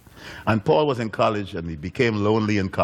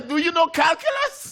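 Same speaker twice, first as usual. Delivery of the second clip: excited shout. Retold or imitated. Grown Paul thrilled the voice is still there, shouting. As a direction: blurt it out excitedly. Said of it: "And he says".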